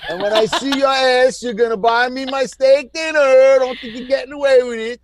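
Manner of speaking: high, playful threat with laughter around it; mock threat. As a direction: playfully threaten the friends who owe you. Calls out friends owing dinners.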